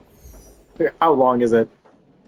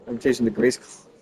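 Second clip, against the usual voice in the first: normal talking voice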